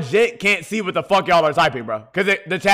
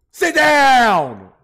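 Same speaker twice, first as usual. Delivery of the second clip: louder, higher sit down; loud command; 5 semitones higher, 4 dB louder than usual. Real, not acted. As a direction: bark it at him. Yelling at someone.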